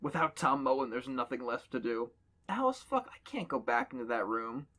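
usual speaking voice

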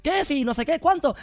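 screaming right in his face; shouting threat. Retold or imitated. Street tough yelling threats at Agustín.

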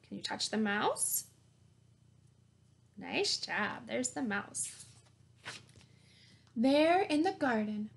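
ordinary delivery, nothing marked

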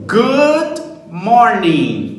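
Good morning drawn out very slowly, falling at the end; slow, exaggerated call for the class to echo; repeat-after-me model. Shown as a demonstration. Teacher leading students in repetition, very slow. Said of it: "Vamos repetir comigo?".

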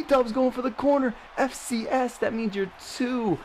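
high excited call, slowing at the end; hyped commentary. Real, not acted. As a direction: drag out the taunt. Running for the corner.

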